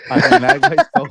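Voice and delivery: words squeezed out through a loud high laugh. Real, not acted. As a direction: crack up mid-sentence. Host bursts out laughing.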